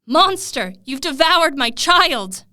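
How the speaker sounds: loud, slow accusing shout; furious shout. Acted. Grieving prince accusing his dog of killing his child, furious shout. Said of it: "he shouted to Gellert".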